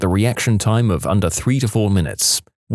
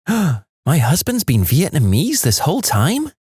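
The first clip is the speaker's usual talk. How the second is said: mock shocked wife; 6 semitones higher than usual. Wife's mock shocked realisation.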